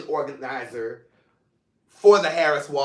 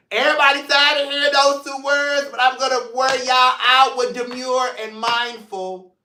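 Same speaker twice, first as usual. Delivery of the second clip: higher and louder, teasing the catchphrase; playful teasing; 7 semitones higher, 4 dB louder than usual. Real, not acted. Host teasing viewers about the demure catchphrase, playful and raised.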